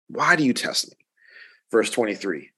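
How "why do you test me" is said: a mild note of exasperation; exasperated. Retold or imitated. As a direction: let your patience wear thin on the repeat. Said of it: "like, just, like, exasperation in, in, in his voice".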